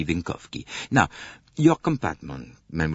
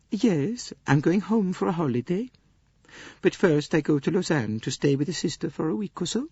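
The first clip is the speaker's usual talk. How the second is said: much higher, gentle Swedish lady; Greta Olson voice; 11 semitones higher than usual. Acted. Swedish lady answering Poirot's questions, high gentle voice. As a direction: lift into a mild, earnest Swedish companion.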